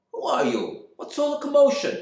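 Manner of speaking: high, loud demand; challenging stranger. Acted. Gilbert challenging the man at the door, loud. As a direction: square up to the stranger.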